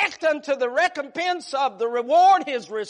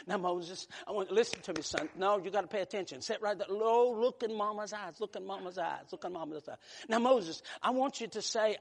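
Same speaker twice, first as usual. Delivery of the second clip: soft, low, coaxing mama voice; gentle mama to her little boy, soft and low; tender mother; 6 semitones lower, 11 dB quieter than usual. Acted. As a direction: hush it down like a mother talking to her toddler. Mother voiced speaking to little Moses.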